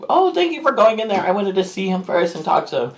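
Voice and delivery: very high voice, easing down; retold reaction starting way up high; high-pitched thanks. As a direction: act out a high-pitched, gushing thank-you.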